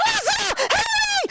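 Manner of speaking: very high shouted call; high shouted plea for help. Retold or imitated. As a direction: yell across to best friend Alicia. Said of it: "I'm like".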